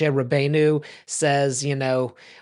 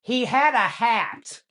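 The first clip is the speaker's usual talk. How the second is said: put-on high, slow, put-out old grandmother voice; a grandmother huffily complaining to the sky; indignant grandmother; 9 semitones higher, slower than usual. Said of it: "she goes".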